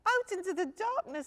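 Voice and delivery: high, loud little cub voice; a small bear cub thanking sweetly. Acted.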